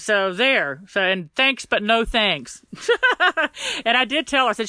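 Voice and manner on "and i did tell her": spoken through a laugh. Real, not acted. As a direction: chuckle through the words. Laughing while recounting.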